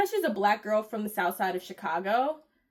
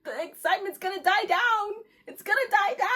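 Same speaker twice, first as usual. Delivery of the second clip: mock crying; 8 semitones higher than usual. Mock-weepy lament over delay.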